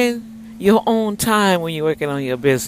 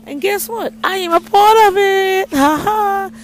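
much higher, louder excited burst; overjoyed squeal; thrilled; 14 semitones higher, 8 dB louder than usual. Real, not acted. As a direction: shriek it out with joy. Thrilled to be part.

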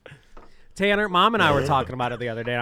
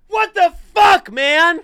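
mock furious shout, much higher and louder; imitating angry yell; 10 dB louder, much slower than usual. Mocking Tanner's temper for the hosts, hammed-up shout. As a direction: ham up his brother's rage. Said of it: "Tanner's like".

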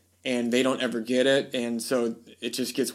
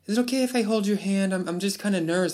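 much higher, timid celebrity imitation; 6 semitones higher, faster than usual. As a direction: play the shy star asking permission, pitched way up. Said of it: "you killing me with that voice".

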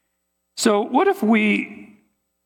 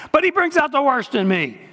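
laughing through the words, a higher pitch; 7 semitones higher than usual. Real, not acted. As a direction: chuckle as you say it. Preacher laughing at his own point.

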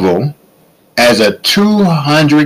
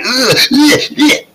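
high repeated disgusted ugh; disgusted groans; 14 semitones higher than usual. Retold or imitated. Disgust at cottage cheese.

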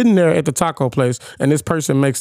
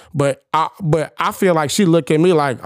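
normal talking voice

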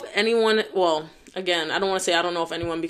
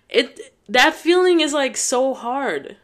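real tearful; 6 semitones higher, 3 dB louder, slower than usual. Real, not acted. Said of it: "I feel my eyes watering up".

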